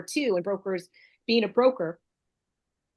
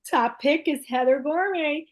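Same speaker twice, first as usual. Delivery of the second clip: excited announcement; 6 semitones higher than usual. Real, not acted. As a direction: announce the winner with a flourish.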